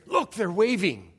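excited, drawn-out parent's exclamation; thrilled parent pointing it out; going crazy over baby. Acted. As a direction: gush loudly over the baby's little move. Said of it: "don't you go crazy".

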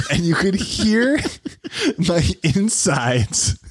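high, broken by giggles; can't get through it for laughing. Real, not acted.

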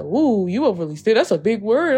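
quick teasing "Woo, you overly stimulated", voice lightly changed; teasing little brother. Retold or imitated. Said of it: "my brother was like".

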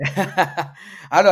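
laughing. Real, not acted. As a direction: laugh it off bashfully. Laughing off a compliment.

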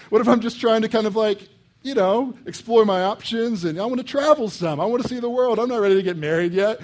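high, loud, hammed-up anxious protest; preacher mocking a modern young man; mock panicked bachelor. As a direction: whine like a commitment-shy single. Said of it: "You'd be like".

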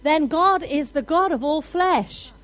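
preacher building to a loud climax; full-force preaching. Acted. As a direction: hammer each line home to the church.

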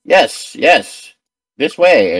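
emphatic, eager character voice. Acted. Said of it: "nods her head emphatically and goes".

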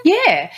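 "Yeah" pitched high, like a question; upward inflection. Real, not acted. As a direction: lift "Yeah" upward in reply. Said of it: "Yeah, with an upward inflection".

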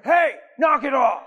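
hammed-up, laughing shout at the kids in the car; parent shouting. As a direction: bark it over your shoulder at the kids. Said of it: "Parents look in the mirror".

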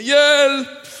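high, loud acted cry for help; Peter roper om hjelp. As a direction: shout it like someone sinking. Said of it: "Så Peter roper". (høyt, spilt nødrop)

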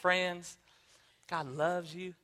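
a soft, soothing megachurch preacher greeting the crowd; Joel Osteen impression. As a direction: coo it gently like a smiling televangelist. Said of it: "Can I do my Joel Osteen impression too?".

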